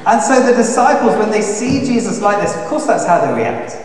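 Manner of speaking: loud, high, urgent retelling; preaching at full force. Acted. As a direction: drive the story home with fire.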